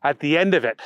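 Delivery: plain reading voice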